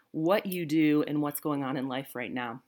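ordinary talk

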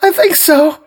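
high, loud sobbing words. A narrator's reading. Wife sobbing to the police, high and loud. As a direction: sob it out. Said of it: "she sobbed".

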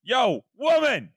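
high, drawn-out holler across the house; mock-bossy shout to a partner; calling out.

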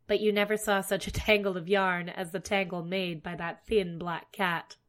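ordinary delivery

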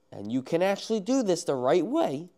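real voice crack mid-sentence. Real, not acted. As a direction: voice cracks up high. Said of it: "sorry for my voice crack".

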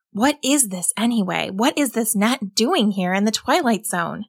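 ordinary delivery

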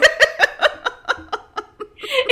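squealing high laugh. Real, not acted. Laughs at the scene.

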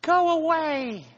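high, frightened voice behind a door; fearful high voice. Acted. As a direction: call out scared from hiding. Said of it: "said a muffled voice".

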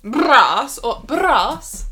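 "Brass" with two different trilled r's, very slow; "Brass" first lip-trilled, then tongue-trilled; rolled r. Shown as a demonstration. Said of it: "I prefer the first one".

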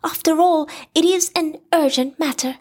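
high, fast, eager stammer; Piglet voice. A narrator's reading. Piglet suggesting a council meeting, high and eager. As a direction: blurt it out high and quick.

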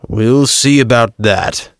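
slow, lightly voiced mocking doubt; chiding. Pilate to Joseph, a slow mild chiding. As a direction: let it drag, a mild chide. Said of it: "Pilate chided".

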